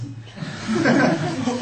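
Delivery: real laugh. Real, not acted. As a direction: let a real laugh break out at the funny answer. Laughs at cookie names.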